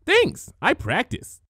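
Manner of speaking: high, bright-pitched thanks; cheerful. Acted. Pinky thanking Spike for the compliment, cheerfully. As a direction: chirp it back happily. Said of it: "Pinky said cheerfully".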